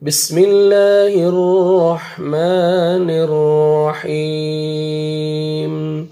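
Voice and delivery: very slow chanted tajweed recitation; Quran recitation. Shown as a demonstration. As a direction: recite with long, sustained melodic vowels. (ٹھہر ٹھہر کر تلاوت)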